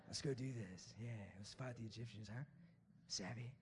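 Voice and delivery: low, soft pirate swagger; Captain Jack Sparrow. Acted. Preacher playing Moses rallying against the Egyptians, Jack Sparrow swagger. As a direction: murmur it low like a cocky pirate. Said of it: "Now he's Captain Jack Sparrow".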